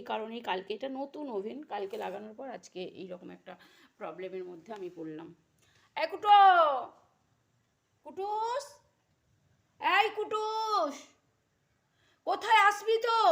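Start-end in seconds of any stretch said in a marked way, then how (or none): 6.0-13.2 very high, loud drawn-out call. Real, not acted. Calling the wandering Kutus by name, loud high drawn-out call. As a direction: holler his name across the house.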